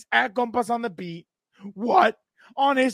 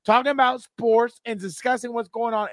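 ordinary delivery

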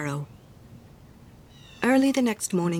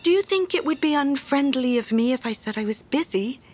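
higher, tentative little voice; shy and timid; 6 semitones higher than usual. A narrator's reading. Hans asking the miller if he may refuse, timid. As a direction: ask timidly, afraid to offend. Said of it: "in a shy and timid voice".

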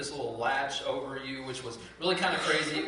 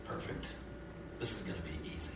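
scheming aside; 10 semitones lower, 13 dB quieter than usual. Retold or imitated. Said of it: "they thought to themselves".

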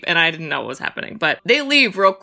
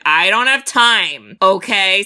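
slow, louder fed-up line; fed-up retort; 4 dB louder, slower than usual. Retold or imitated. Friend talking back to the villain, slow and fed up. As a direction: lay it out slowly, annoyed. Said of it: "I was like".

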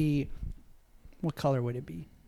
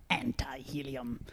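whisper; 4 semitones lower, 12 dB quieter, slower than usual. Acted. Awed echo of the word.

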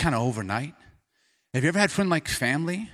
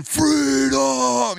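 high, loud battle shout; Braveheart cry; 12 semitones higher, 7 dB louder than usual. Retold or imitated. Picturing Mel Gibson rallying the men, loud high shout. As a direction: yell it like a movie warrior. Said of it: "like, Braveheart".

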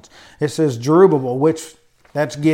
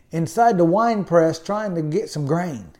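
usual speaking voice

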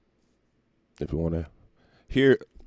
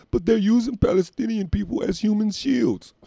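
mock excuse; 14 semitones higher, 3 dB louder than usual. Mocking the official excuse.

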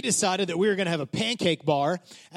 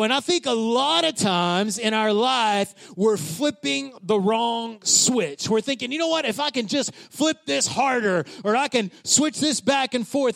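raised, emphatic preaching voice; 5 semitones higher than usual. Acted. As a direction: drive the point home to the congregation. Preaching the sermon point.

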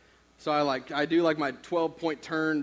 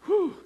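much higher, louder breath of relief; relieved whew; 5 dB louder than usual. Retold or imitated. Boy after dad forgives him, a big relieved whew. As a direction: let it all out in relief. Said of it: "I was just like".

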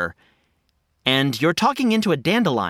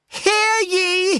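town crier; 14 semitones higher than usual. Acted. Herald proclaiming a decree.

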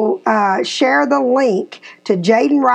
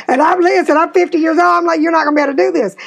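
excited; 6 semitones higher, much faster than usual. Retold or imitated. Mother laughs, retelling her own words.

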